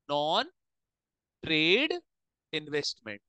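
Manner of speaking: spaced out with long pauses while writing; word by word. Real, not acted. Teacher writing a term for students, word by word. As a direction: say each word as you write it, pausing between.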